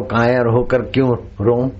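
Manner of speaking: usual speaking voice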